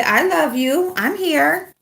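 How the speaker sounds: high voice for the dog; voicing the dog. Owner voicing her dog's love, high and sweet. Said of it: "You say".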